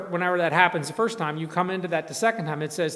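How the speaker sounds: ordinary talk, nothing marked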